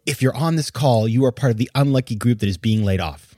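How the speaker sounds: ordinary talk, nothing marked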